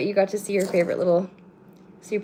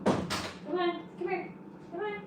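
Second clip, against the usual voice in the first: high-pitched, coaxing call to a pet; 11 semitones higher, 8 dB quieter, slower than usual. Real, not acted. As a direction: call the cat over in a high, soft voice. Calling pets from across the room.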